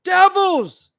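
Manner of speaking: Devils shouted high and loud, one hard word; emphatic repeat. Real, not acted. Host to his audience about women, repeating Devils as a loud high shout. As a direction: hammer the word Devils home. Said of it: "these are devils".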